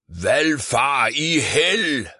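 huge drawn-out Viking roar, high and loud. A narrator's reading. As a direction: bellow it slowly like a wild Viking. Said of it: "brøler han".

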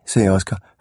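ordinary delivery

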